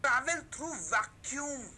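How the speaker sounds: very slow, word by word, as if writing it on the board; slow classroom dictation of a note. Shown as a demonstration. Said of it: "Let me write that down".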